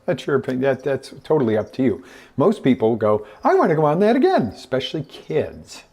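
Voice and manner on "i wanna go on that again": high, loud, rapid demand; excited eagerness. Acted. Kids begging for another ride, excited eagerness. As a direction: burst out like an eager kid.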